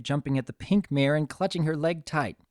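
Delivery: plain reading voice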